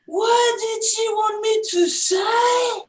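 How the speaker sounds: hammed-up high wife screech, drawn out; wife's shrieking. Wife's first thing in the morning, slow mock shriek. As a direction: wail it loud and high, imitating the wife. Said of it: "first thing she says in the morning".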